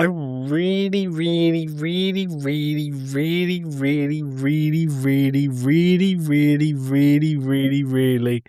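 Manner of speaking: slow, stretched string of really; drawn-out repetition. Acted. Telling the hosts how badly he wanted to hate it, slowly drawn out. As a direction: drag out each really in a long chain.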